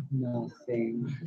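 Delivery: imitating a hurt girlfriend, barely audible; half-hearted pouting reply; sulky sad. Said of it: "you already know someone's sad on the phone".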